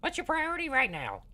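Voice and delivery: high squeaky gnome; Grupham's gnome voice. Acted. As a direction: pitch way up like a little gnome. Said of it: "this is my gnome voice for Grupham".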